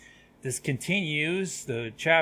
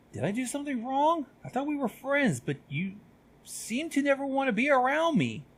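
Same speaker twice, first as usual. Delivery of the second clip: hurt friend; 12 semitones higher than usual. Acted. Friend asks why he is avoided.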